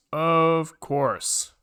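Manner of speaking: ordinary talk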